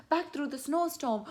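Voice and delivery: high-pitched, hurried storybook alarm; playful panicky urgency; urgent. Shown as a demonstration. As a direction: rush it as if fleeing a bear. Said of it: "go through this very quickly, like it's urgent".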